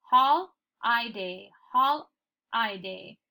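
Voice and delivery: holiday slowly as "hol... EYE-day"; wrong sounded-out holiday. Shown as a demonstration. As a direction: break holiday after "hol", long I next. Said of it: "we don't say holiday".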